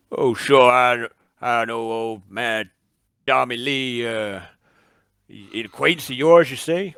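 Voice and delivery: a lightly voiced barkeep answering a stranger; barman. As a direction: chat like a helpful bar owner. GM plays the barman.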